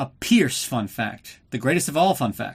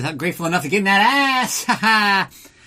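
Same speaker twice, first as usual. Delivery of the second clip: higher and louder, hammed-up lecherous shout; crude mock Bond line; 7 semitones higher, 6 dB louder than usual. Bond answering the Contessa, hammed-up leering shout. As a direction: bellow it like a leering parody of Bond. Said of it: "And Bond says".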